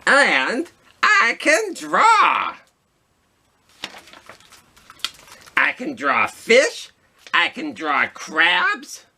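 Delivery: high, slow proud squid voice; giant squid. Acted. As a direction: boast like a cheerful cartoon squid. Reads giant squid character.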